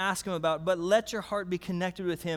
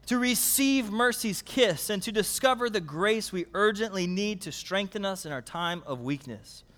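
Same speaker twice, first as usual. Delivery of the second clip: usual speaking voice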